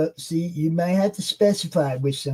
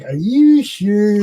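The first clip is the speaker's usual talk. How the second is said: retold disbelief; 7 dB louder, slower than usual. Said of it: "And I was like".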